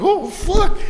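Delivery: loud, high-pitched alarm; mock panic. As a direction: act out sudden dread, voice shooting up. Retelling own panic in car.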